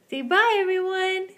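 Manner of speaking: loud high cheerful farewell; loud sign-off. Real, not acted. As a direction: call out goodbye high. Sign-off to viewers.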